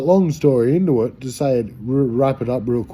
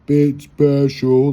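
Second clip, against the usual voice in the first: very slow, drawn out; 3 semitones higher, 5 dB louder, much slower than usual. Shown as a demonstration. Mocking himself as a bit special, in slow motion. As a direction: stretch each word out in slow motion. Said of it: "like slow-mo".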